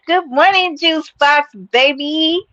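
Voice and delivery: bright greeting. Real, not acted. Greeting a chat member on stream.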